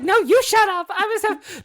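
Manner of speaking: high, loud squawked comeback; replayed outraged screech; indignant retort. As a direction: yell back, affronted and over the top. Re-enacting the quarrel.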